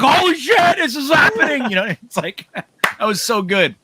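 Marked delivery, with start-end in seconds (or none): 0.0-1.6 retold excited shout. Cohost replaying the host's call of the run, excited shout. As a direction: yell it like the play is unfolding live. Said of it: "and you're like".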